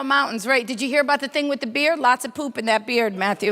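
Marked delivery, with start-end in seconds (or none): none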